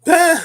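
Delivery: sudden high, loud laugh; burst of laughter. Real, not acted. Amused speaker breaks up mid-thought, sudden loud laugh.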